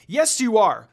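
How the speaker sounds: loud, emphatic declaration; declared. A narrator's reading. Moroku insisting to Inuyasha, loud and emphatic. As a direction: slam the point home. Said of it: "Moroku declared again".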